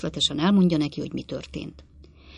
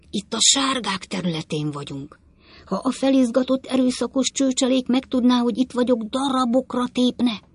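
fearful conspirator; 7 semitones higher than usual. Acted. Pott fears the mob.

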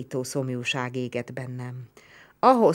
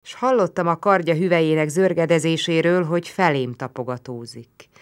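usual speaking voice

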